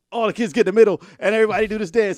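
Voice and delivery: loud, high party host hype. Retold or imitated. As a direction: pump up the dance floor. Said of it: "they want me to be like".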